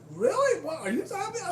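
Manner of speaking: very high, loud disbelief; mock shocked boss. Boss reacting to being told off, shrill disbelief. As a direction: squeal it like she can't believe it.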